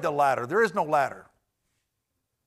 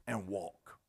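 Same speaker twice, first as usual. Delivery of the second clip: soft final command; 10 semitones lower, 13 dB quieter than usual. Acted. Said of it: "He says".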